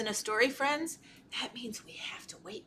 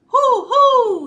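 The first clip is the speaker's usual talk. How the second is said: two high, loud hoots; high owl call; 12 semitones higher, 13 dB louder than usual. A narrator's reading. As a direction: hoot out like an alarmed owl. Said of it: "he called".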